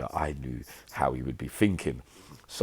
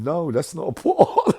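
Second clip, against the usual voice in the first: laughing; 12 semitones higher, 9 dB louder than usual. Real, not acted. Laughing at the mix-up.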